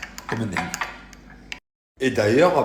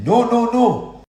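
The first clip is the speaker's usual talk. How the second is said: high-pitched, drawn-out comic denial; retold emphatic denial; 8 dB louder, much slower than usual. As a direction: act out a loud, squeaky protest. Acted denial to the insistent stranger.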